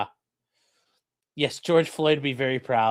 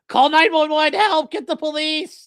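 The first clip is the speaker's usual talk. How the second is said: much higher, louder mock plea; mock cry for help; 11 semitones higher, 6 dB louder than usual. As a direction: parody the woman calling for help. Mocking the woman's cries for help.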